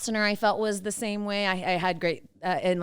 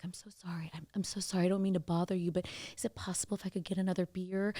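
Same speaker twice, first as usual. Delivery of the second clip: very quiet, almost whispered at first; hushed apology; 10 dB quieter, faster than usual. Retold or imitated. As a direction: softly imitate an apologetic, polite request. Imitates a shy, apologetic customer asking.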